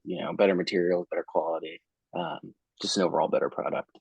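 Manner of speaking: over the phone. Real, not acted. Remote client on video call.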